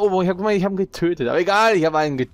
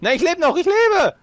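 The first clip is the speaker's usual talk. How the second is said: excited shouting; 4 dB louder than usual. Real, not acted. Urging teammate, high stakes.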